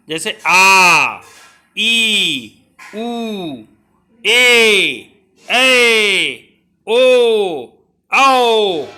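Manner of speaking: each vowel आ, ई, ए, ओ held long and loud; drawn-out long vowels. Shown as a demonstration. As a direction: stretch every vowel to double length. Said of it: "दूना समय लगता है". (स्वरों को लंबा खींचकर बोलना)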